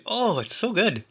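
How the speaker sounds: ordinary talk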